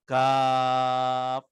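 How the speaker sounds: Kaf drawn out long; held Kaf. Shown as a demonstration. Teacher reading the opening letters. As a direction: hold Kaf steady. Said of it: "dibacanya mat lazim".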